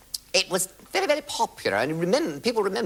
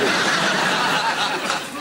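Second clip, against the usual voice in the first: laughing; 9 semitones higher, 5 dB louder than usual. Real, not acted. Laughs at own sketch.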